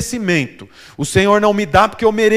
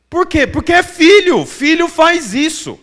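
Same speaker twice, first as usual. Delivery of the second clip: higher and louder, the answer hammered home; emphatic preaching; 8 semitones higher, 4 dB louder than usual. Acted. Preacher booming the answer to his congregation. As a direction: proclaim it to the whole church.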